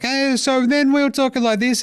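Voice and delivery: sudden high-pitched put-on voice; Tyrese Halliburton's weird voice. Host imitates player's second voice, high and weird. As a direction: flip up into a high odd voice. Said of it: "literally two different voices that they talk in".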